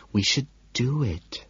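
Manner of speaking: softly. A narrator's reading. Evelyn hinting at marriage to Patrick, soft and slow. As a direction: say it gently, almost pleading. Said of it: "she says softly".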